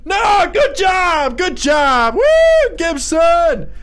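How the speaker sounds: high, drawn-out shouts of praise; slow, elated yelling; cheering praise. Real, not acted. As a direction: whoop for the winner. Cheering a player's win.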